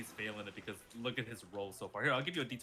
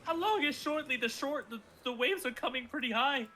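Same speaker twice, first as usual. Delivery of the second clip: high anxious questions; panicked; 10 semitones higher, 3 dB louder than usual. Acted. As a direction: fret as the tide rises. Liam panicking about tide.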